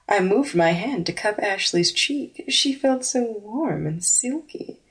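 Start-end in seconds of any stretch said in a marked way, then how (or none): none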